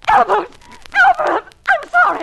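high, loud, frightened cries; terrified pleading. Acted. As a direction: plead in panic as he grabs. Wife cries out as attacked.

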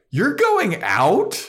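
high, slow disbelieving squeak; incredulous. Retold or imitated. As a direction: scream your disbelief, stretched. Mocking disbelief at Buffy.

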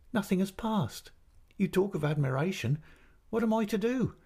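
high-pitched, aggrieved mother; tearful reproach. A narrator's reading. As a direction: scold as if close to tears. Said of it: "shedding more tears than before".

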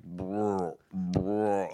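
wobbly lip-rolled "brr"; imitated lumbering rumble. Co-host imitating huge lineman's sprint, lumbering rumble. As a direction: make the noise of a heavy body rolling along.

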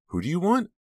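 high, loud nervous question; anxious and high-pitched; alarmed demand. A narrator's reading. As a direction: demand it of a caller who scares you. Frightened woman answering unknown caller.

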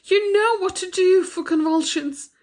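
very high, panicked wail; distraught, tearful pleading. A narrator's reading. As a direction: plead desperately for the baby's life. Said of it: "wringing her hands and sobbing".

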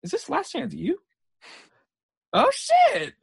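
rising to a high, loud yelp of disbelief; startled realisation climbing into a yelped curse; retold shock. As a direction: replay the moment it sinks in, ending in a sharp high cry. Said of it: "it all dawned on us".

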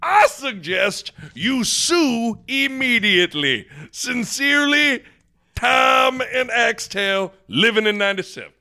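slow, hammed-up indignant letter from a Nebraska fan; mock angry fan letter. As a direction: read the complaint like an indignant old fan. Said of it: "emails coming in from Axtell".

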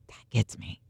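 real, choked-up whisper; moved whisper. Real, not acted. Host overcome while retelling the story, whispering. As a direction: let it catch you, barely voiced.

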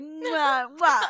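loud smacking mock kisses; kiss sounds. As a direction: blow big smacking kisses. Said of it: "she's like".

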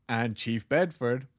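usual speaking voice

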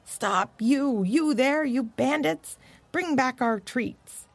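high slow calls; a mild mock yell from far off; lightly voiced dogs calling out, drawn out. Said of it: "dogs yelling from the road".

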